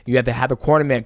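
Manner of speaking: ordinary talk, nothing marked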